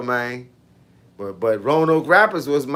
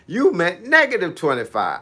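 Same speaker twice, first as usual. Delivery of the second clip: ordinary talk, nothing marked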